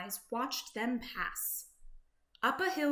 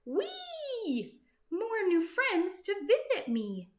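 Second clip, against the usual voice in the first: much higher happy cry; delighted whee; 9 semitones higher than usual. Acted. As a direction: squeal with joy at new friends. Woodchuck delighted.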